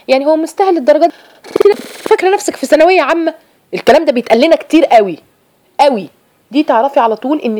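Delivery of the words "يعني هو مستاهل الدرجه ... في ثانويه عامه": higher, teasing disbelief; mocking friend. Friend mocking a sister's effort, high teasing scoff. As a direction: scoff at her as if she overdoes it. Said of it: "تقول لها ايه؟".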